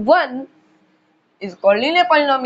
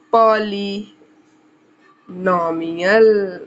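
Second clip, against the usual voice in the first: very slow, lower, syllables pulled apart; drawn-out dictation; 5 semitones lower, much slower than usual. Real, not acted. Teacher writing the last topic heading, drawn-out dictation. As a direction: stretch the word out as you write.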